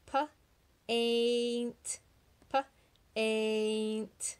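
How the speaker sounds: Pa-aint with the long A drawn out; stretched A sound. Shown as a demonstration. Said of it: "Does it have the long A sound?".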